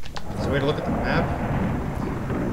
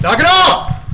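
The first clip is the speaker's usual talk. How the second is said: loud, high-pitched angry shout; exasperated yell; 13 semitones higher, 10 dB louder, slower than usual. Real, not acted. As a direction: blast it out at the pursuer, pitch jumping. Yelling at game pursuer.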